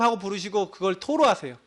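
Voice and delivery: ordinary delivery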